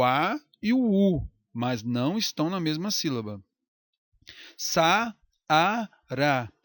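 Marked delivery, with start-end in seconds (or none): 4.6-6.5 Sa-a-ra said syllable by syllable with breaks; split into syllables. Shown as a demonstration. Teacher to students showing the hiatus in Saara, syllable by syllable. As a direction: pull Sa-a-ra apart at each syllable. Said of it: "aproximação do primeiro a com o segundo a".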